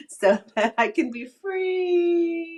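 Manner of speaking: high, slow, emotional and emphatic. Real, not acted. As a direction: stretch it out, voice rising with feeling. Declaring her hope to be free.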